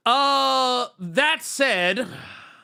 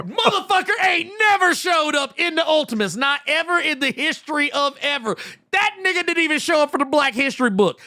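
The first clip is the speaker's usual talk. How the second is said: high, loud, outraged shouting; furious rant; 8 semitones higher, 4 dB louder than usual. Real, not acted. Streamer ranting to chat, loud outraged shout. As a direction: yell it in full disbelief. Heated rant about the comic.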